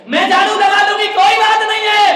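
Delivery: loud, high protest; insistent shout. Retold or imitated. Mother shouts at her son that she will sweep, insisting.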